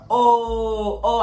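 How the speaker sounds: drawn-out mocking "oh"; jeering classmates. Whole class reacting to her jokes, drawn-out oohing. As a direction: lightly imitate a crowd's reaction.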